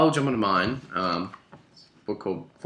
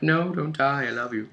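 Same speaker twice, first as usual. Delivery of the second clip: pleading fan; 4 semitones higher than usual. Retold or imitated. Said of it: "you end up just being like".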